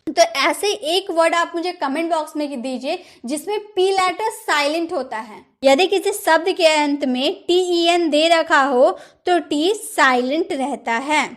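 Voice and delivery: ordinary delivery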